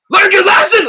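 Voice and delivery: very loud, high shout. Real, not acted. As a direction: shout it to tease her. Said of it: "I'm so loud".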